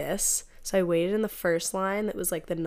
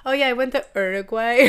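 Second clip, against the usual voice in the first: Spanish pronunciation; 4 semitones higher, 7 dB louder than usual. Retold or imitated. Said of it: "like, say something that's".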